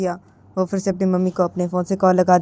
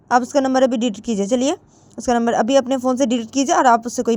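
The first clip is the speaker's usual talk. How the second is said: high, pleading daughter; Jiya insisting; 4 semitones higher than usual. Acted. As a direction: order her mother to delete the number, higher. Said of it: "तो जिया बोलती है".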